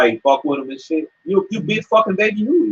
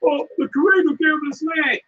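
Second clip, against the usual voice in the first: much higher mock pleading voice; mocking whine; 11 semitones higher than usual. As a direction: parody them with a high, whiny plea. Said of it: "They told".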